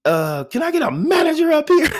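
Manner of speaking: high, mock cashier paging the store; loudspeaker call. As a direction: call out for a manager over the loudspeaker. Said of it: "She goes on a loudspeaker".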